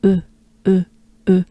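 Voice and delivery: "E" as rounded "euh"; French e sound. Shown as a demonstration. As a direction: push lips forward for French "E". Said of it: "the letter E in French".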